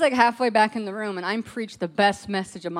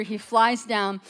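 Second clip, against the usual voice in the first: normal talking voice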